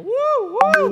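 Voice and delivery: very high, loud whooping; imitated crowd whoops. Manado congregation answers the preacher, high loud whoops. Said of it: "Oh, mereka".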